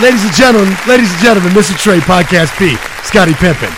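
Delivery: booming ring announcer. Pretend. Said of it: "announcer intro with applause effect".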